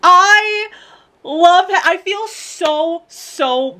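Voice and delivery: high, pained squeal of love; strained high cry. Real, not acted. Fan gushing in high-pitched anguish. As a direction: squeeze the words out in anguish.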